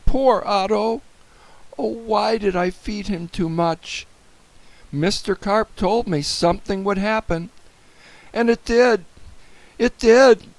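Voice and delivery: high-pitched regretful wail; distressed lament. A narrator's reading. Boy laments his mistake.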